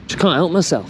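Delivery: ordinary delivery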